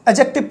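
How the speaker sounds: एडजेक्टिव said as "a-jective", no D; silent D. Shown as a demonstration. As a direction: drop the D sound in एडजेक्टिव. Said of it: "पढ़ेंगे".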